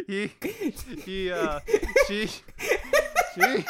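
high, words dissolving into laughter; real helpless laughing. Real, not acted. Trying to tell about the blood tasting, helpless with laughter. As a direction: struggle to start the sentence through giggles.

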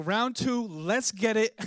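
pumped up. Retold or imitated. Acting out eagerness for more food.

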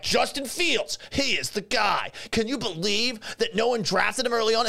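high, eager mock fan voice; a lightly hammed-up fan pitch; mocking know-it-all fan. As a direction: parody an overconfident fan. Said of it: "some of these same people are like".

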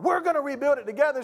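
high, quick, insistent rallying; rally someone who is panicking; forceful urging. Acted. Hero rallies the panicking woman before the jump, forceful urging.

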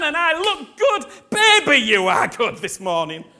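rising into a loud, high, laughing boast; joyful declaration. Pretend. Preacher voicing a believer telling God, then joking, loud and joyful. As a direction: proclaim it to God with growing delight. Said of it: "you have got to go".

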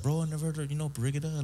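a hint of an island bro who never heard of Barigada; Chamorro guy voice. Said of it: "he was like".

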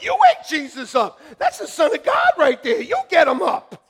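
loud urgent yell; yelling to wake Jesus. Retold or imitated. Disciple yelling to wake Jesus in the boat, loud. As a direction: shout it like waking someone in a storm. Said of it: "I'm like".

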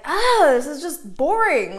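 drawn-out, loud groan of boredom; bored exclamation. Retold or imitated. Coach retelling how she woke up today, loudly bored. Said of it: "I was like ... boring".

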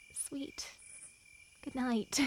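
soft whispered endearment; whispering. Acted. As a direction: breathe it in a whisper. Tender parting at night.

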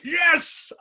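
loud, high-pitched triumphant yes; elated shout. Retold or imitated. Buddy jumping up at being picked, mock elated shout. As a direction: burst out as the thrilled buddy.

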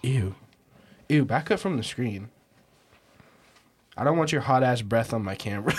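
normal talking voice

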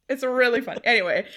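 normal talking voice

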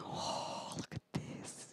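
hushed whisper, barely voiced. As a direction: breathe it in awe at the fruit. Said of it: "Johnny's looking at the fruit like".

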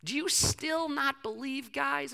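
high, drawn-out appeal; acted pleading. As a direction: implore them slowly, pitch lifted. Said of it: "he's pleading with them".

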